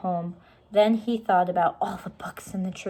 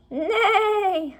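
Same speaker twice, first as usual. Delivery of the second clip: high loud whinny; storyteller imitating a horse neigh; 14 semitones higher, 6 dB louder than usual. A narrator's reading.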